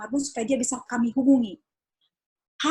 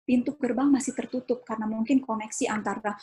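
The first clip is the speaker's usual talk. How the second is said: ordinary talk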